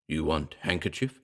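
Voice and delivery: a lightly voiced gorilla, pidgin and soft. A narrator's reading. Ape offering the reporter a handkerchief, lightly voiced gorilla. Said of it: "said the ape".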